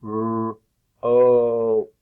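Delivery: held "R", then loud long "O" closing on p; segmented sounds. Shown as a demonstration. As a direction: sound out rope as "R, O". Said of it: "take apart the sounds".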